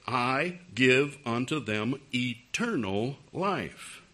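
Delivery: slow emphatic scripture quote; slow, deliberate verse reading. Acted. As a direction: read it out slowly, word by word. Reads the verse out in witnessing scene.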